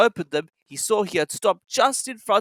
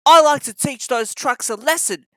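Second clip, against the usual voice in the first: higher, louder determined voice; Thomas; 7 semitones higher, 5 dB louder than usual. A narrator's reading. Thomas vowing to teach the trucks a lesson. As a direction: say it with resolve. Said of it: "said Thomas the Tank Engine".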